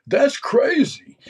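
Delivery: very high, loud, drawn-out comic impersonation; Tracy Morgan. Retold or imitated. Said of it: "I do a horrible impersonation of him".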